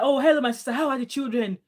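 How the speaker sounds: cheerful hello and question; excited greeting. Retold or imitated. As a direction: greet a sister from home. Colleague greets her in locker room.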